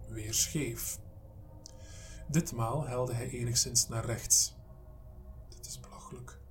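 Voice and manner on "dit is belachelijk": barely voiced mutter under the breath. A narrator's reading. Mike mutters to himself at the crooked door, under his breath. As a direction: mutter it, almost without voice. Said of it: "mompelde Mike".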